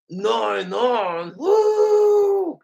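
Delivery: repeated cartoon gag with a piercing high whoop; Regular Show voice. Friend repeats his gag on cue, Regular Show whoop. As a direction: build from no into a loud falsetto woo. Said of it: "who else does a regular show voice".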